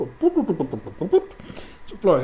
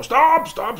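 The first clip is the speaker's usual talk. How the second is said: high, loud shouted stop; shouting stop; 13 semitones higher, 7 dB louder than usual. Acted. Frej shouting at travellers crossing his field, loud. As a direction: yell stop at trespassers. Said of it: "kommer og råber".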